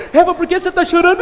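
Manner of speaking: loud laughing shout; mock shout. God asks crying Eva, laughing shout. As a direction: call out through laughter. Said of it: "Brincadeira, não foi desse jeito".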